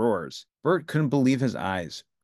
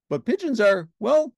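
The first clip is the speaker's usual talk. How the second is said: high, bright Muppet Ernie voice; cheerful Ernie, pitched well up; 10 semitones higher, 6 dB louder than usual. A narrator's reading. Ernie answering Bert, in a high cheerful voice.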